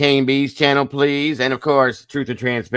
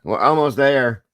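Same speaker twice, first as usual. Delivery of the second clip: "almost there" pitched up high; playful emphatic repeat; 5 semitones higher, 4 dB louder than usual. Pretend. Streamer cheering viewers toward more likes, high emphatic repeat. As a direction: lift "almost there" higher, drawing out "there".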